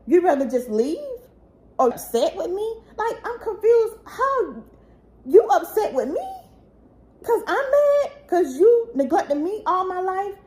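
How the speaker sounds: hurt rising into anger and tears; real upset outburst. Real, not acted. To her biological father, venting how he neglected her, upset and near crying. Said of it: "I'm angry".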